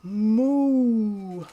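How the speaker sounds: long steady moo; first moo. Shown as a demonstration. Little pig trying its first moo. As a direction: moo like a cow. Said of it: "mix that ooh sound".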